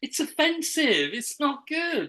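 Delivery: high sing-song Welsh; Welsh accent. Acted. Judging their own attempt, still in high Welsh accent. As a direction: stay in high, lilting Welsh. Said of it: "Do your Welsh accent".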